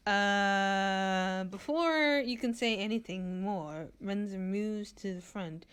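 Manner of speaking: ordinary talk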